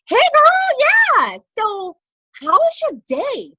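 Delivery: high, loud, gushing friendly hello; bubbly greeting. Shown as a demonstration. Seller demonstrating her upbeat check-in call to customers, gushing. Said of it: "So I'm like".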